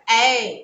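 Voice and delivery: ऐ said with the mouth opened wide, a long open vowel. Shown as a demonstration. Teacher to children, demonstrating ऐ with mouth wide open. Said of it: "ऐ के लिए मुंह को अधिक खोलेंगे".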